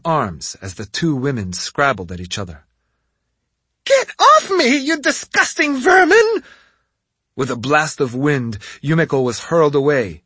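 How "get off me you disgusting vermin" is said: high, loud furious witch; outraged shriek. A narrator's reading. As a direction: shriek in disgust. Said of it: "a shriek of outrage from the witch".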